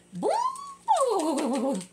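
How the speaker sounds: high, ringing boom; bouncy boom. A narrator's reading. Narrator booms as the kitty lands on his bed.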